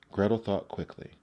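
ordinary delivery, nothing marked

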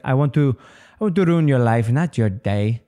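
deliberately bad Indian, Middle Eastern accent; badly faked Indian accent; horrible Indian accent. Shown as a demonstration. Comic showing what gets him booed, horrible Indian accent. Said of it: "horrible Indian, Middle Eastern accents".

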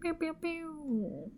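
squeaky laser pew pew; high "pew pew" sound effect. Pretend. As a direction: toss in a playful high laser noise. Playful cheer after patron name.

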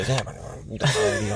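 quiet, slow grumble under the breath; mock irritated mumble; imitated muttering. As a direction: mutter it as you walk away. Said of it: "Esta gente que murmura".

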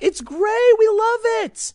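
sarcastic falsetto mimic of industry people; mocking gushing insiders. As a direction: parody an airheaded Hollywood fan. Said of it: "people in the movie industry being like".

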